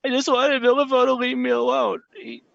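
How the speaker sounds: put-on high, broken sobbing child voice; a frightened boy blurting a confession through tears; boy confessing to the priest, high and tearful; crying boy. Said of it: "begins, like, crying".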